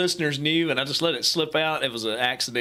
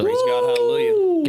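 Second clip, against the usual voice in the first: much higher and louder, a preacher-style cry of joy; jubilant shout; 6 dB louder than usual. Real, not acted. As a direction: throw it up like a church amen. Cohost cheers the farmland bill.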